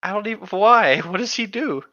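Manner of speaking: high, loud, baffled why; incredulous. Real, not acted. Asking her friend why she'd like H3H3, loud disbelief. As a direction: demand why, genuinely baffled.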